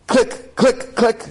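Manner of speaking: imitated jammed gun clicking. Jammed submachine gun, imitated clicking. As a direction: click it out like a dead trigger.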